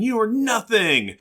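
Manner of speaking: high, drawn-out mock scream; screamed retort. Podcaster quoting Laura attacking the demon, mock scream. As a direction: act out a scream without really screaming. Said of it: "she's all screaming".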